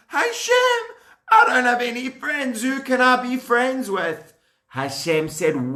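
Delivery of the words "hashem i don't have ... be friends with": lonely Adam. Acted. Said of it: "Adam was feeling so lonely".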